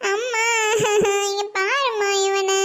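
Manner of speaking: wailing out to mother through sobs; tearful, whiny tattling; crying. Acted. Child complaining to mother about brother, crying. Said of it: "எதுக்குடி அழுதுட்டு இருக்க".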